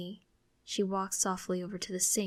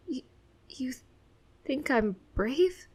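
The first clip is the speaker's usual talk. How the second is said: halting, higher, slow question; bashful question; 5 semitones higher, 4 dB louder than usual. A narrator's reading. Child moved by mom's praise, bashful and halting. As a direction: stammer it shyly, near tears. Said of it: "I ask bashfully".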